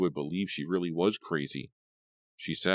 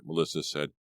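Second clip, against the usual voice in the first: plain reading voice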